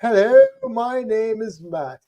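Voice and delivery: southern-twang British accent. Shown as a demonstration. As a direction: show off an Australian twang, drawn out. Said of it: "the southern twang of British accents".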